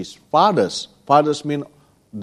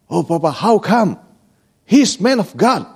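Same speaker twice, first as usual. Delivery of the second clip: surprised daughter; 5 semitones higher than usual. Retold or imitated. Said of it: "Cardia say".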